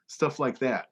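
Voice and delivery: ordinary delivery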